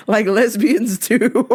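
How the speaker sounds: high laughing squeal; high giggly aside. Real, not acted. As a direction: crack up while saying it. Laughing at own joke.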